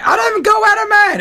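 character's cry. Retold or imitated. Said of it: "[name] was like".

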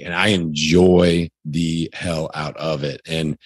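ordinary talk, nothing marked

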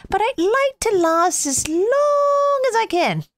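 high, wistful snowman plea; hope aloud as the snowman. Acted. Snowman pleads to king.

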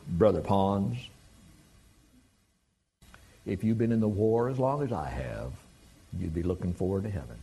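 low Southern Tennessee drawl; lightly put-on Southern Tennessee drawl; Mark Cameron. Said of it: "as only Mark could do in his Southern Tennessee drawl".

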